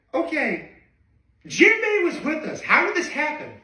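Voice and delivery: Robin alarmed. Retold or imitated. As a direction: squeak it out like a worried crewmate. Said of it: "And Robin is like".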